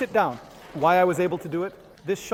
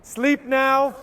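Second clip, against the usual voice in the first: hypnotic command. Shown as a demonstration. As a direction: snap him into sleep. Demo of shock induction.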